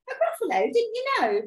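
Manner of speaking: high little mouse voice; knowing mouse. Acted. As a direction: pipe up as if everyone knows. Mouse answers the snake.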